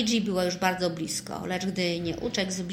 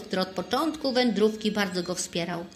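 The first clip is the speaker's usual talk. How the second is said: usual speaking voice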